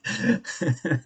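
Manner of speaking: short high laugh; high giggle. Real, not acted. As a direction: giggle at the ending. Amused by the ending.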